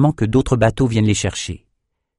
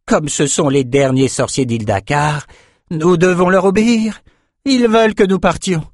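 rising, higher-pitched frustration; grudging report, voice climbing ever higher; vexed; 8 semitones higher than usual. A narrator's reading. Liès reporting the sorcerers' orders, vexed. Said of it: "tout aussi dépité".

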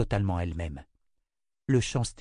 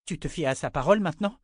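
challenging; 6 semitones higher, faster than usual. Acted. Wife challenging husband.

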